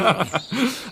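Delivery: short amused laugh, rising; pleased chuckle at himself; light laugh. Acted. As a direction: laugh off the teasing. Amused reply to teasing.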